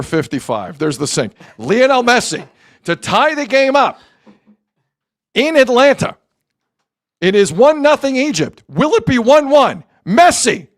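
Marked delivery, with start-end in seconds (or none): 1.6-10.7 raised suspense building to a high scream; dramatic play-by-play. Real, not acted. Sportscaster calling Messi's penalty and the save, building to screaming. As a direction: build the tension, then explode in a long shout. Live call of penalty kick.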